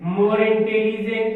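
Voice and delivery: very slow, word by word; drawn-out teacher dictation. Shown as a demonstration. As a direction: spell it out slowly as you write on the board.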